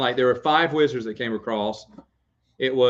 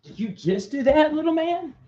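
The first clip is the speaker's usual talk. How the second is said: dad cooing at the son after a soggy diaper; 13 semitones higher than usual. Real, not acted. Dad talks to his baby.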